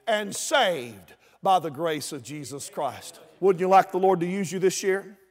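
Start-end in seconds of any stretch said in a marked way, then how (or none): none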